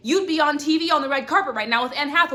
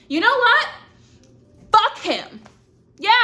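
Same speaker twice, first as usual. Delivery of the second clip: much higher, louder defiant burst; mock angry outburst; 11 semitones higher, 7 dB louder than usual. Showing viewers how to get angry at a cheater, loud mock outburst. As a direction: shout it off in fake fury.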